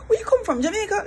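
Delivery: very high-pitched, eager mock question; excited questioner. Stranger hearing one little accent, imitated as eagerly curious. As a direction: imitate someone pouncing on a familiar accent.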